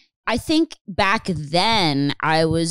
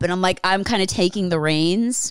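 normal talking voice

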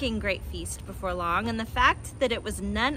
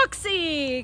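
higher, delighted call; excited cry; 6 semitones higher than usual. A narrator's reading. Badger greeting Fox underground, excited cry. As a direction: cry out to a long-lost friend. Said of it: "cried Badger".

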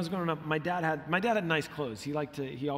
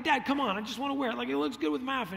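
put-on whiny kid pleading; 7 semitones higher, faster than usual. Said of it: "I would be like".

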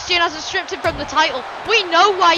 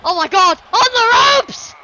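loud cry rising higher; excited shout; 6 semitones higher, 6 dB louder than usual. Real, not acted. Commentator sees him reach the ropes, excited shouting. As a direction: burst into a high, loud call. Reacts to a rope escape.

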